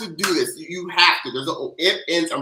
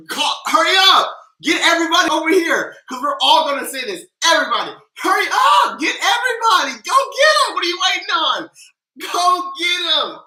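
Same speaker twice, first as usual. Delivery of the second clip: excited yelling; 8 semitones higher than usual. Real, not acted. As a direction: rush everyone over, yelling.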